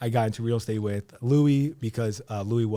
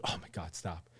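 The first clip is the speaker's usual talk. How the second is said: softer and lower, touched; overwhelmed hush; 7 semitones lower, 14 dB quieter than usual. Retold or imitated. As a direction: murmur it, moved by the kindness. Overwhelmed by friend's kind offer.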